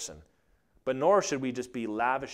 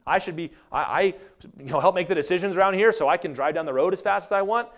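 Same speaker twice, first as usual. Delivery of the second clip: raised, pushy entitled driver whining; louder, higher mock of a smug driver; entitled whine; 6 semitones higher, 6 dB louder than usual. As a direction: demand it like you own the road.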